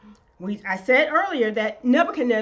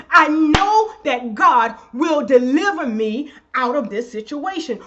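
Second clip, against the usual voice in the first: ordinary talk, nothing marked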